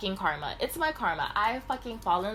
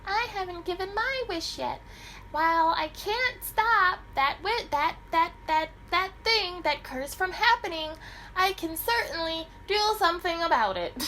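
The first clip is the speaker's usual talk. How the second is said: little fairy voice; 11 semitones higher than usual. Acted. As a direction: squeak it out like the tiniest fairy godmother. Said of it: "the little, she's like".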